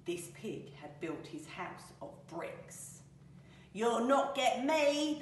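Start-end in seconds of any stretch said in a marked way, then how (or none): 3.7-5.2 slow, loud defiance from a piglet; plucky pig standing up to the wolf; defiant cry. Acted. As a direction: cry it out slowly and firmly. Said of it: "the piggy cried".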